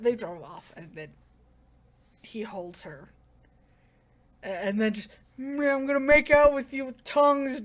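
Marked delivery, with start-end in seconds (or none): 5.4-7.6 higher, louder goofy put-on voice; mock tongue kissing. Acting out a tongue kiss, silly mock voice. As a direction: ham up a sloppy make-out voice. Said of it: "my interpretation of tongue kissing".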